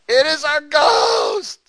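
high loud slow cry of fear; terrified shout. Retold or imitated. Disciples seeing him on the water, a high terrified shout. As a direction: scream it like frightened fishermen. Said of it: "Saying what?".

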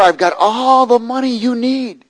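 imitating a slow, high, loud holler; retold yell. Man yelling his offer to the preacher, imitated yell. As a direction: holler it out, stretching the words. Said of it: "he starts yelling to me".

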